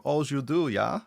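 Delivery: put-on teasing Austrian-accented questions. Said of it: "I hear this accent all the time".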